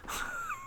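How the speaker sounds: sigh. Real, not acted. Heavy emotional reaction.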